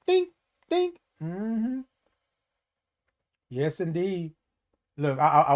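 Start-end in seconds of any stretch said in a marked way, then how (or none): 0.1-1.0 high squeaky "think, think" like a thought chime; imitated chime in the head; high sing-song think. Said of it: "what I heard in my head was think".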